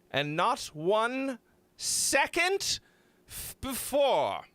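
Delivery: high, emphatic mock-bossy order; hammed-up scolding, drawn out at the end; mock stern command. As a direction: lay down the law in mock outrage. Mock-commanding playback not to start.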